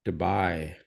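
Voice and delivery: ordinary delivery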